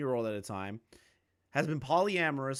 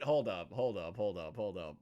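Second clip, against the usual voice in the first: muttered stalling; 8 semitones lower, 5 dB quieter than usual. Real, not acted. Stops himself in confusion.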